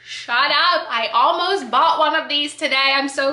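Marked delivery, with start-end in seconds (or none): 0.0-0.9 surprised gasp. Real, not acted. Sees item she almost bought.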